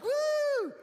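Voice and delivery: high loud whoop of joy; excited woo. Retold or imitated. Excitement mocked.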